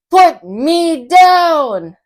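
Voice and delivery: high, slow plea to be put down; upset fish begging to be set down; fish pleading. Acted. Fish pleads while held up.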